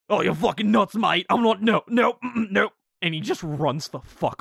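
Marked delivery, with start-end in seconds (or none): 0.1-2.7 loud, high panicky Australian orc; Australian accent. Acted.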